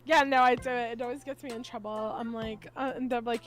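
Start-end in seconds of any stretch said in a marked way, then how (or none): none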